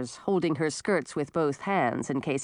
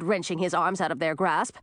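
usual speaking voice

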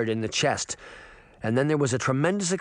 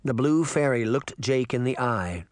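usual speaking voice

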